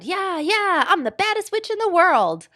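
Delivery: very high gleeful witch scream. A narrator's reading. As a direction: shriek it like a wild witch. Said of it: "ran screaming".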